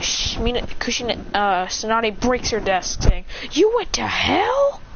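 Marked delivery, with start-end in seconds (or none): 3.5-4.8 high, drawn-out incredulous question; outraged, squeaky disbelief; shocked outburst. Acted. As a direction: blurt it out in high-pitched disbelief. Tsunade breaks desk, shocked outburst.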